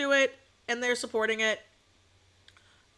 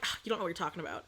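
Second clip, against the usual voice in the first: quick dismissive mutter; 5 semitones lower, 9 dB quieter, much faster than usual. Retold or imitated. Quoting her dismissive thought.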